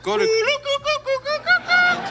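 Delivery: words break up in high, slow laughter; trying to keep going through a laughing fit. Real, not acted. Host cracking up on the show intro, laughing high and slow.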